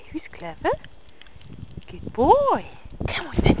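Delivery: normal talking voice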